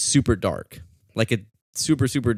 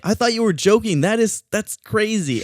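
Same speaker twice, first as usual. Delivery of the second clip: amazed; 11 semitones higher than usual. Retold or imitated. Reacting to her discovery.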